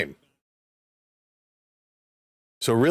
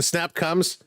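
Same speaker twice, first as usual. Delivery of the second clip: ordinary delivery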